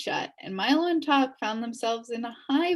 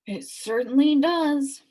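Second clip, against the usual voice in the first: slow put-on dog voice; the watchdog agreeing with Milo; gruff dog Tok; 6 semitones higher, 6 dB louder than usual. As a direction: answer steadily. Dog figure replies.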